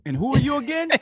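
high, loud put-on challenge; mock challenge. Co-host playing someone questioning authority, mock challenge. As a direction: playfully demand to know who this is.

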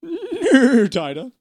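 high, loud neigh into words; whinny the first word, then speak; loud horse whinny turning to speech; demanding horse. Acted. Horse demands tighter knot.

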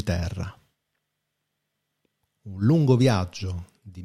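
calm solemn reading voice; quote reading. Acted. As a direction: read it like a poem. Reads a Lao Tse quote.

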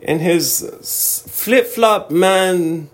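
high, slow, drawn-out fake Austrian accent; mock Austrian accent. Host imitating Austrians who love a Florida comedian, mock Austrian accent. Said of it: "And they're like".

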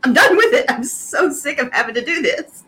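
hammed-up exasperation, easing off at the end; exasperated. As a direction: act out a hero who has had enough.

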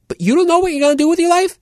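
fast, squeaky-high disbelief; incredulous. Retold or imitated. Kid challenging his aimless friend, incredulous. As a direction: rush it out high in mock astonishment.

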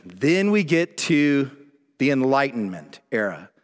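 ordinary talk

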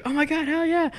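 high-pitched mock squeal of joy; retold fan excitement. Retold thrill at meeting his idol.

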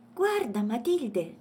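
high, loud little elephant; excited exclamation. A narrator's reading. Elephant shows Matilde the light, exclaiming. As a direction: call out to show a discovery. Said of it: "esclamò Agostino".

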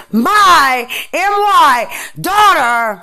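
"my" spelled out M Y, slow and loud; stress on my. Real, not acted. Defending her daughter to officials, slow, loud stress on "my". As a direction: hammer "my M Y" letter by letter, slowly and loudly. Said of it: "let me emphasize".

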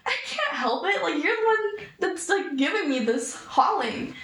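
high-pitched, exasperated pleading; indignant retold whine at an accuser; retold protest. Retold protest to angry staff member.

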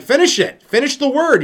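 high, loud, pushing him to finish; impatient plea. Pretend. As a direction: zeurend hoog aandringen: maak het af. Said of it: "You're like".

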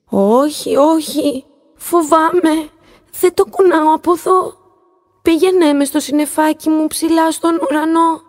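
high, scared pleading little voice; trembling plea. A narrator's reading. Little star begs the dolphin to take her home, scared. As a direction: beg him, frightened, voice trembling. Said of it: "τον παρακάλεσε με τρεμάμενη φωνή".

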